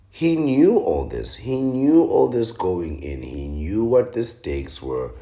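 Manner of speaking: hammering home that he knew the stakes; emphatic. Real, not acted. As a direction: drive the point home.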